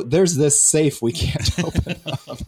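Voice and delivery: high, laughing through the words. Real, not acted. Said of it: "I shouldn't be laughing".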